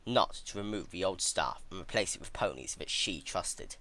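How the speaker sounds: usual speaking voice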